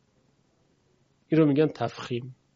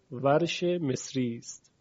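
ordinary talk, nothing marked

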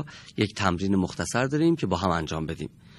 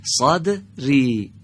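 صدری said higher, louder and slow; louder model; 4 semitones higher, 5 dB louder, much slower than usual. Shown as a demonstration. Teacher showing student how loud, louder. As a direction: call out صدری clearly and slowly. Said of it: "بلندتر بگید".

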